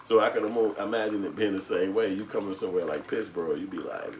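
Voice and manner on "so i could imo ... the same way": ordinary talk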